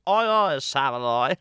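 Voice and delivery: loud, high yell of Oi oi Savoy; shouting. Retold or imitated. The dame yells at the audience, a loud high shout. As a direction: bellow it out like a pantomime dame.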